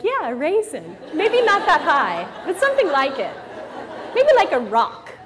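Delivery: high, loud mock teen girl, laughing through it. Teen daughter mocking her mother, high and giggly. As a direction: play the eye-rolling teenage daughter with a giggle. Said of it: "I like girls who are like".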